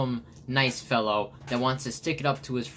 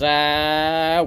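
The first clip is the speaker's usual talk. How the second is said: loud, higher, sustained mock scream; cartoon scream; 5 semitones higher, 7 dB louder than usual. Flowey skidding down the mountain, loud mock scream. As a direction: hold a loud open yell. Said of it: "and he goes".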